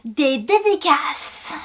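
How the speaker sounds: very slow, dramatic trailer announcer. Acted. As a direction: draw it out like a coming-soon teaser. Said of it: "voix de bande-annonce".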